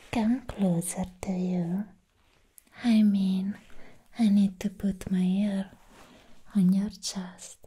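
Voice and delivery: usual speaking voice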